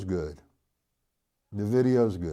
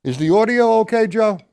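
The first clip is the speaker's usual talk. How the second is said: loud, high-pitched check with someone away; calling out; 12 semitones higher, 7 dB louder than usual. Real, not acted. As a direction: call it across to Joe, raised voice. Calling to Joe off mic.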